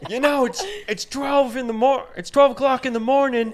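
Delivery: mock annoyed neighbor; complaining neighbor. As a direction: scold him about the hour. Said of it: "he was like".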